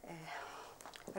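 breathy hushed sigh of a secret; conspiratorial hush; hushed aside. Acted. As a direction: lean in and hush it. Secretive gossip, asks privacy.

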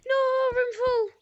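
loud, high and drawn out; frustrated outburst. Real, not acted. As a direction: groan it out at the screen. Game room turns out full.

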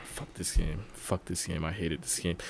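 subdued muttered frustration; low grumble. Real, not acted. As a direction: grumble quietly to yourself. Grumbling at the game.

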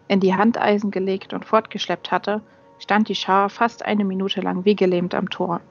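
ordinary delivery, nothing marked

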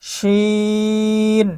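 শিন with a long held ee; three-alif stretch. Shown as a demonstration. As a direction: draw out the vowel of শিন evenly for three alifs. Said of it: "তিন আলিফ টান হবে". (শিন তিন আলিফ টেনে)

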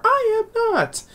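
very high, drawn-out falsetto protest; mock indignant denial. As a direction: squeal the denial like a cartoon character. Imitated argument over crying.

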